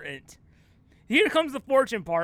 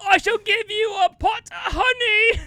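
high, loud grand proclamation; hammy actor; 11 semitones higher, 6 dB louder than usual. Acted. Actor rewarding the bear with honey, grandly theatrical. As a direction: promise it with storybook pomp. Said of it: "he'll be like".

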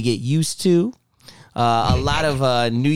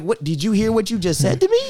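higher, incredulous comeback; offended disbelief, pitched up; indignant retort; 8 semitones higher than usual. Retold or imitated. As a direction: toss it back like you can't believe it. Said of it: "I'm like".

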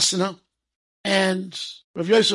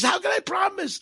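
put-on despairing boy; 12 semitones higher than usual. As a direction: cry out in helpless despair.

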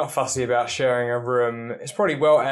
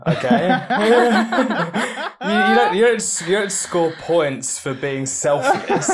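laughing while talking; 6 semitones higher, 3 dB louder than usual. Real, not acted. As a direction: crack up mid-sentence. Laughing through her own joke.

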